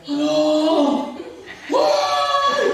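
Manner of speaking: a hammed-up cry for help; patient screaming. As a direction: yell out from a sickbed. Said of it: "dia teriak-teriak di rumah".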